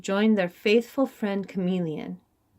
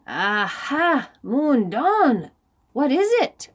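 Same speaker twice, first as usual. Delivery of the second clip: anxious king; 5 semitones higher, 4 dB louder, slower than usual. Acted. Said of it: "asked the king anxiously".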